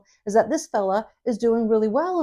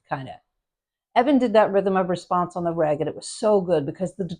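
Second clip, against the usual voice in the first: ordinary delivery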